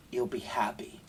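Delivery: soft, breathy ad-style whisper; whispered sales pitch. Acted. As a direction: whisper the promise like a seductive advert. Mocking an ad's sales promise.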